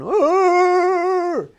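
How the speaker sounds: long, high, sustained dog howl; imitated dog howl. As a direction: howl like a hurting hound. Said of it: "his dog kept bow wowing".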